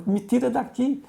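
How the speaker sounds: high, pleading retold cry for help; a hint of a desperate woman pleading; desperate plea. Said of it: "mas desesperada".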